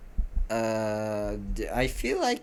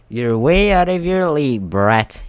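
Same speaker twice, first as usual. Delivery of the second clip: loud thug's taunt, starting high; raised, menacing character yell; shouted; 3 semitones higher, 9 dB louder than usual. A narrator's reading. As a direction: shout it down at a cocky kid. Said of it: "the tallest shouted".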